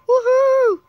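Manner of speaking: high, loud whoop; excited cheer. Real, not acted. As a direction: whoop in triumph. Celebrating a jump.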